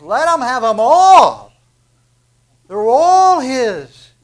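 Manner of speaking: slow, high, loud proclaiming cry; loud joyful John. Retold or imitated. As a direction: shout it out with joy for the crowd.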